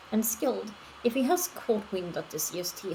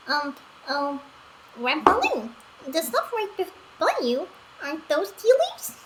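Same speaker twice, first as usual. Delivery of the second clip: a hint of an eager little companion, higher; lightly voiced high companion; 7 semitones higher, 4 dB louder than usual. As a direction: point it out brightly, a little higher.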